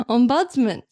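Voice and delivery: ombudsman with the stress on BUDS; said as a word. Shown as a demonstration. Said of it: "Say it as a word".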